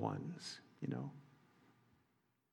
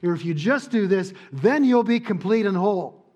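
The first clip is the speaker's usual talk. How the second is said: higher, louder seductive temptation; 6 semitones higher, 9 dB louder than usual. Acted. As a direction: play temptation luring them in. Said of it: "Because temptation says".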